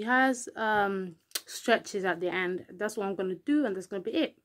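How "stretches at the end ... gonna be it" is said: ordinary talk, nothing marked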